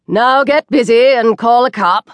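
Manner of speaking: cocky customer giving orders; brash demand. A narrator's reading. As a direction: boss the waiter around brazenly. Diner brazenly orders the waiter.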